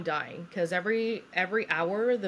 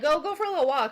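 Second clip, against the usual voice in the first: lightly imitated staff, higher and louder; a chirpy mock suggestion, pitched up; breezy staff voice; 9 semitones higher, 5 dB louder, faster than usual. Nurses send labouring patient walking, breezy mock voice.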